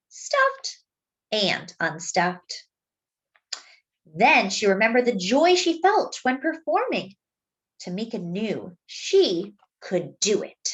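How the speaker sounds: put-on gentle read-aloud for children; story narration. A narrator's reading. Narrator reading a story to young listeners, gently. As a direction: tell it like a bedtime picture book. Reads the picture book aloud.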